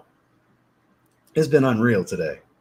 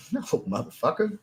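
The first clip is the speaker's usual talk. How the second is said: retold refusal; 5 semitones higher, 3 dB quieter than usual. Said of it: "I'm like".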